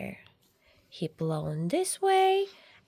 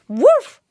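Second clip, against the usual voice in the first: mock dog bark; 10 semitones higher, 14 dB louder than usual. Dog's bark.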